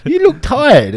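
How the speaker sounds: high, loud imitation of Ara's mum; high nagging mum impression; mock mum voice. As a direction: squeak it out like a fussy mum. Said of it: "I can't even do an accent".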